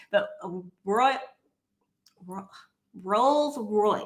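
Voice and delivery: Rolls Royce with halting, stumbling start; struggling with r. Real, not acted. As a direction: fight through the R in Roy-, Ro-, Rolls Royce. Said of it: "I cannot say my R's".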